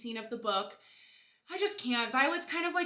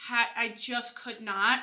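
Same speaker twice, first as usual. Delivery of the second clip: ordinary talk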